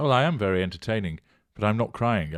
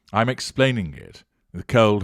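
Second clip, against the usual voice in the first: ordinary talk, nothing marked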